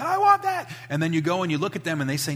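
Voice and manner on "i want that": put-on high-pitched demanding child, much higher; a child whining for a toy; whiny kid. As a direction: squeal it like a greedy toddler. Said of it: "the kid's like".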